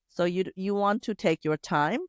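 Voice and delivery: usual speaking voice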